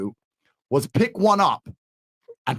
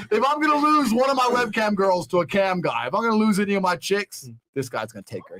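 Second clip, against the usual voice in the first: usual speaking voice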